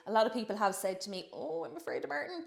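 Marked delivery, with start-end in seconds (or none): 1.3-2.5 higher, softer mock-timid voice; jokingly afraid. People telling her about Martin, playfully mock-afraid. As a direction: feign a little fear, lightly. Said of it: "jo-, joking".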